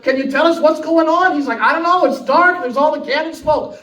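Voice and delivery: shouting up from below decks, high; calling up. Retold or imitated. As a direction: call out over the cannon noise. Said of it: "They're like".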